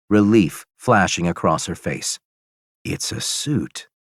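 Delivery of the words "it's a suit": softer, slow, dry remark; quiet slow remark. Acted. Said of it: "a man ... says".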